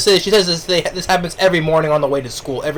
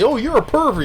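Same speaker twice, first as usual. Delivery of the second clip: put-on indignant girl voice; 7 semitones higher than usual. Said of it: "And she says".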